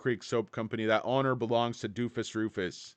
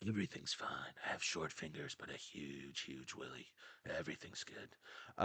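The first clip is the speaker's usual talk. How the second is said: breathy secret whisper, far softer; mock whisper; 7 semitones lower, 14 dB quieter than usual. Vlogger jokes to chat about a secret, hushed whisper. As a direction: confide it in a hushed joking whisper.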